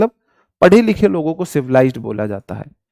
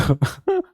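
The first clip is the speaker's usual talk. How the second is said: a quick high giggle, soft; a brief amused laugh; short laugh; 8 semitones higher, 5 dB quieter than usual. Real, not acted. Teacher laughing at a viewer's silly game idea. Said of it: "अरे हंस रहा हूँ यार".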